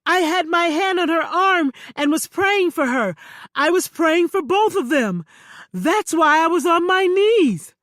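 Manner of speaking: loud, insistent excuse; defensive man. Acted. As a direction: plead your innocence.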